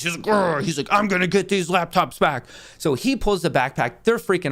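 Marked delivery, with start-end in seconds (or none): 0.2-0.6 mock angry "grr"; imitated growl. 0.9-2.5 mock growling tough-guy voice, pitched up; macho boyfriend impersonation. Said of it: "Mister Redshirt, being the boyfriend he is".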